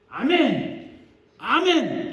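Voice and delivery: loud, high amen punched out separately; imitating American worshippers' emphatic amens; imitated American amen. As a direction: call each amen out forcefully. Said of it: "이렇게 해요".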